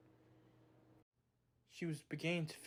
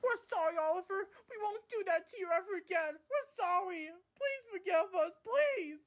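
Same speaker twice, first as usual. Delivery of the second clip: high, loud near-crying plea; tearful pleading; 4 dB louder than usual. Acted. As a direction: beg for forgiveness in tears. Scared freight cars begging.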